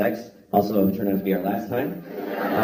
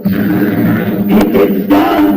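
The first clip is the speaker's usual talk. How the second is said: loud, louder epic proclamation; triumphant shout; 3 semitones lower, 8 dB louder than usual. Acted. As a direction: boom it out like a victory. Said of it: "I just go".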